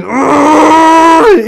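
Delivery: very high, loud roar of pain. Acted. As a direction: scream as the glass goes in. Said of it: "all you just hear is Mike going".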